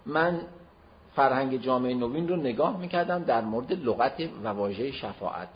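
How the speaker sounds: ordinary delivery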